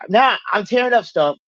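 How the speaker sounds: ordinary talk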